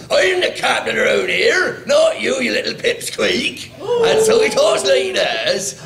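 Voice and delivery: loud, high-strained big pirate voice; shouted cartoon pirate bluster; Captain Lapoutine. Shown as a demonstration. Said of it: "he's a great big pirate who talks like this".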